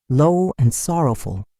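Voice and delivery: ordinary delivery, nothing marked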